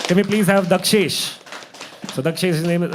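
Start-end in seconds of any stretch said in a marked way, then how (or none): 0.1-1.4 loud, slow, higher emcee call; host introducing the next act to the crowd, loud and drawn out; announcing performer. Real, not acted.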